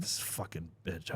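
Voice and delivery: low, soft grumbled curse under the breath; muttered curse. Retold or imitated. A man recalling his quiet fury at the customer, low soft curse. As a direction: grumble it to yourself, seething quietly. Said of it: "I was like".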